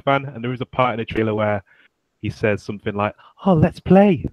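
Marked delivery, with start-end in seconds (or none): 3.5-4.3 high, loud mocking film-star impression; weird Mark Wahlberg voice. Co-host mimicking the trailer's worst line, weird high voice. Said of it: "say it in that weird voice".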